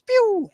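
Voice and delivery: high squeaky pew sound; firework pew. Retold or imitated. Imitating firework noise.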